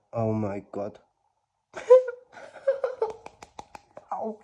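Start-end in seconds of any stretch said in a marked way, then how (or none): 1.7-4.1 high, squealing giddy laugh; real delighted squeal-laugh. Real, not acted. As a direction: let out a shrieking laugh of joy. Giddy after the pull.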